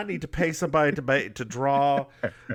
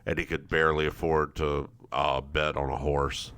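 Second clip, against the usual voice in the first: deep, lowered quoted voice; low man voice; 11 semitones lower than usual. Retold or imitated. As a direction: drop low for a gruff man. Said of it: "he's like".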